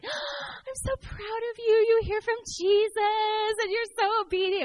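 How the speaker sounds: high, drawn-out squeal of pretend pride; gasp, then coo in an exaggerated sing-song; mock warm-fuzzy gushing. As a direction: gush sky-high, hammed-up adoration. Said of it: "it wasn't like this warm fuzzy".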